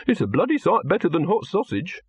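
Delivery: indignant retort. A narrator's reading. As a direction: retort with offended pride. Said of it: "Silverfish demanded".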